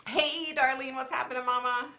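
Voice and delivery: sing-song hey mama; cheery hello. Real, not acted. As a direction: call out a happy greeting. Greeting a friend cheerfully.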